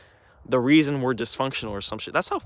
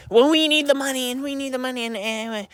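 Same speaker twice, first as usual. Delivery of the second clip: much higher, mock whiny voice of Mom; 12 semitones higher than usual. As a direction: mimic Mom in a high, nagging squeak that trails off.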